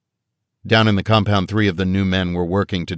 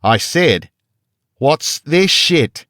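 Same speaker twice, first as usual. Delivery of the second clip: slow, loud, much higher "what's this shit?", stretched with a hard fall on "this"; guard losing patience, far louder, in an Australian accent; raised voice; 9 semitones higher, 6 dB louder, much slower than usual. A narrator's reading. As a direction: bellow each word slowly in an Australian accent. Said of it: "He raised his voice".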